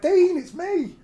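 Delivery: hammed-up terrified plea to drunk mate; mock panicked plea. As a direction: shriek it like a scared kid. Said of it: "I was like".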